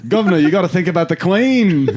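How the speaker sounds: high, hammed-up British voice with laughter; British accent. British mortician to his governor, hammed-up high British accent. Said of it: "accent-wise".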